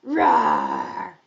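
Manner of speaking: big rough lion's roar. Shown as a demonstration. Teacher shows children the story cue, big lion roar. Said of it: "and say".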